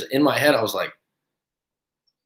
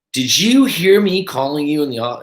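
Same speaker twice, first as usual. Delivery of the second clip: irritated manager; 5 semitones higher, 5 dB louder than usual. Retold or imitated. Annoyed manager confronting him.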